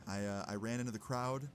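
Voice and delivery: normal talking voice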